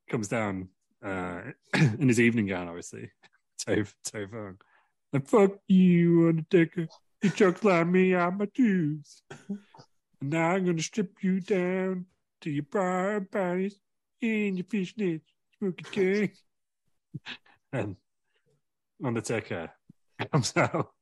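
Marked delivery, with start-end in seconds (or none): 5.1-16.3 high, slow, ranting wrestler taunt; hammed-up wrestler promo. Terry Funk cutting a promo on the Undertaker, high and slow. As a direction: stretch out a mock promo.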